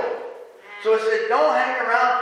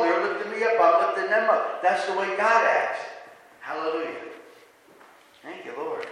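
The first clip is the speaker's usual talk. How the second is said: ordinary talk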